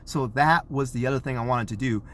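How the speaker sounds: ordinary talk, nothing marked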